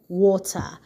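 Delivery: ordinary talk, nothing marked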